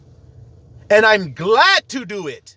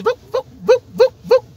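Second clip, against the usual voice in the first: high, bouncy boop noises; swinging sound effect; 4 dB louder than usual. Retold or imitated. Imitating a gibbon swinging through trees, high boops.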